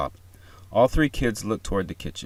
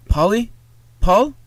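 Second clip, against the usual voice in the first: faint distant call; 14 semitones higher, 9 dB louder, slower than usual. A narrator's reading. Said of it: "Voice filter, very faint".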